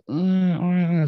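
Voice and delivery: high-pitched mock baby whine; imitated baby cry. Father imitating his baby's cries, mock wail. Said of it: "Dari nangis, nggak usah dia ngomong".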